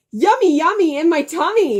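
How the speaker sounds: lightly voiced grinning rhyme; a hint of a gleeful chant; grinning singsong. [name] grinning over his cupcakes, light singsong. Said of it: "with a huge grin".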